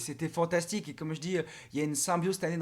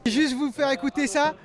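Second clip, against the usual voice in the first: louder, slower over the crowd noise; raised voice; 6 semitones higher, 5 dB louder than usual. Real, not acted. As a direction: speak up over the announcer. Talking over loud speaker announcer.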